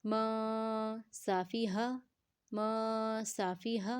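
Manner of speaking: "م" drawn out nasally, then even "صافی ہا"; held nasal ikhfa. Shown as a demonstration. Teacher repeats the ikhfa sound for learners, held nasal sound. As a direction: hum on "م" before releasing "صافی ہا". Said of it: "اخفا کا sound کریں گے".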